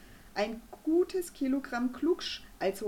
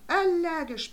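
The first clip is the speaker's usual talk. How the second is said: much higher, louder emphatic confirmation; firm confirmation; 8 semitones higher, 8 dB louder than usual. A narrator's reading. Doctor confirms the diagnosis to the mother, emphatic. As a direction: stamp the word down as fact. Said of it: "bestätigte Doktor Bumm".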